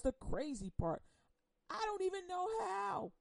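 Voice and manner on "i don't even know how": much higher, baffled insistence; incredulous, pitch leaping up; high-pitched bafflement. Real, not acted. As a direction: repeat it way up high in disbelief. Repeats it in high disbelief.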